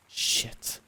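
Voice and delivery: breathless whispered swear; whispered curse. Acted. Boy hiding from his father, whispering a curse under his breath. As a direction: hiss it so no one hears.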